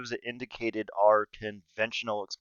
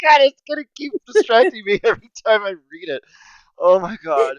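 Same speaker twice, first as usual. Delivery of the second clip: laughing; 10 semitones higher, 6 dB louder than usual. Real, not acted. Still laughing, can't stop.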